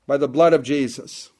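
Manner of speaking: normal talking voice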